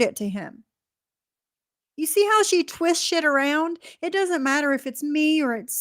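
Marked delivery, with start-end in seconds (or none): none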